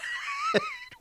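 real high-pitched laugh. Real, not acted. Host mocking his own rambling to listeners, real high laugh. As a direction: break into laughter mid-sentence.

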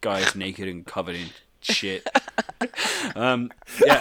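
laughing through the words; giggling while telling it; real laughter breaking in. Real, not acted.

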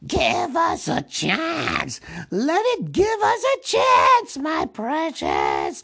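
high, loud, drawn-out creature screech; spluttering Gollum. Acted. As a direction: plead in a strained, hissing Gollum voice. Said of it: "He whispered and spluttered".

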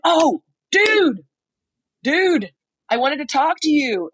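high-pitched, drawn-out fan excitement; starstruck enthusiasm. Retold or imitated. Retold starstruck greeting.

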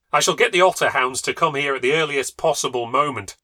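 savagely. A narrator's reading. Husband vowing to call the otter hounds, loud savage threat. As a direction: vow to hunt the beast down. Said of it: "said Egbert savagely".

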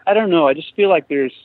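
ordinary talk

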